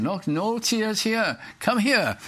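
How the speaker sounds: preacher voicing God welcoming a mourner home, high and tender; God's gentle voice. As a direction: soothe a weeping soul, drawn out. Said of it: "And he said".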